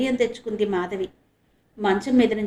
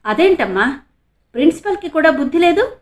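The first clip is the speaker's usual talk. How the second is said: angry question, higher and louder; 6 semitones higher, 6 dB louder than usual. A narrator's reading. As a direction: demand indignantly. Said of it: "కోపంగానే అన్నాడు ఆనంద్".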